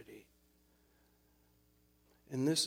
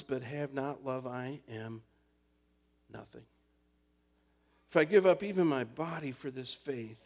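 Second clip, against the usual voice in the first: scripture recitation; 5 dB louder than usual. Acted. Pastor reciting First Corinthians to the congregation, solemn. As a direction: read scripture with weight, fading each ending.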